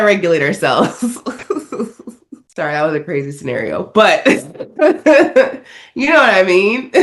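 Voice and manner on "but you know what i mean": high, loud burst of laughter through the words; laughing while talking. Real, not acted. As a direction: crack up mid-sentence. Cracking up after own scenario.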